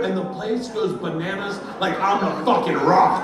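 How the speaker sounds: usual speaking voice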